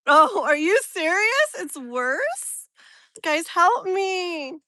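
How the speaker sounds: loud, high cry of disbelief; exasperated outburst. Real, not acted. Streamer begging viewers for help with the sun, exasperated. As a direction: plead loudly for rescue.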